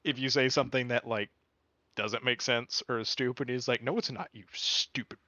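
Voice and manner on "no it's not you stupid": ordinary talk, nothing marked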